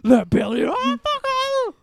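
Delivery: high, switched personality Billy; Billy persona. Acted. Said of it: "It's Billy".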